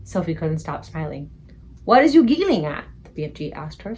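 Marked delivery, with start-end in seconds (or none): 1.8-2.8 slightly nettled. A narrator's reading. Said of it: "slightly nettled".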